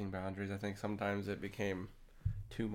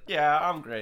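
cocky; 5 semitones higher, 9 dB louder, slower than usual. Retold or imitated. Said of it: "as in I'm agreeing being cocky".

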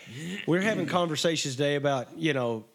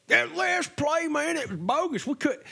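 whiny mocking voice; 12 semitones higher than usual. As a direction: mimic a whiny fan. Mocking complaining fans.

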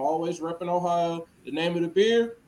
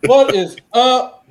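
loud greeting; 5 semitones higher, 10 dB louder, slower than usual. Real, not acted.

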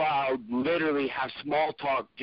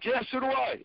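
heckler butting in; 12 semitones higher than usual. Retold or imitated. Audience member butting in.

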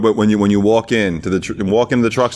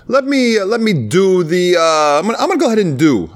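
mock indecisive customer; 7 semitones higher than usual. Customer ordering from an annoyed server, mock dithering. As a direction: stall on the order, pitched up. Mimicking customers he hated serving.

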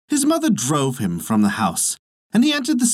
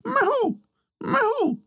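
very high falsetto owl hoot; screech owl imitation; slower than usual. Old woman hiding in the ivy, imitating an owl's cry. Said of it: "scream like an owl".

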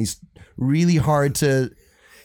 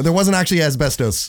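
usual speaking voice

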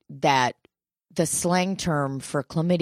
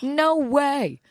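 high disbelief; 9 semitones higher than usual. Retold or imitated. Disbelief at test result.

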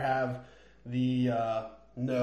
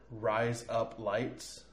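quiet, deliberate demo of "Rise up, lights"; slow, separated words; 3 semitones lower, 8 dB quieter, much slower than usual. Shown as a demonstration. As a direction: say "Rise up, lights" slowly, word by word. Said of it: "if you say it in normal speed".

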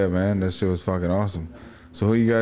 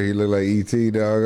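ordinary talk, nothing marked

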